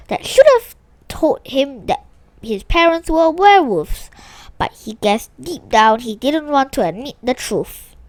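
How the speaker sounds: usual speaking voice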